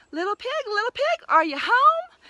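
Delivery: high, loud call through the door. A narrator's reading. Wolf calling the pig at the straw house door, high and loud. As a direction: sing out to the pig inside.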